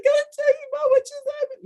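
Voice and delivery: excitedly bursting in with news; breathless rush. Retold or imitated. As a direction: blurt it out like you can't wait. Rushing to her pastor after.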